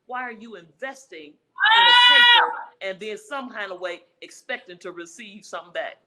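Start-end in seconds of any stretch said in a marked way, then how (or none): 1.7-2.5 suddenly loud, driving the word home; preaching peak. Real, not acted. Pastor pressing the audience, loud emphatic preaching. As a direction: preach it out full force.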